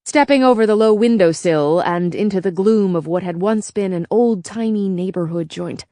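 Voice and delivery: ordinary delivery, nothing marked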